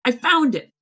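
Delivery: triumphant eureka; excited discovery. Retold or imitated. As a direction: relive finding the lost poem. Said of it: "I'm like".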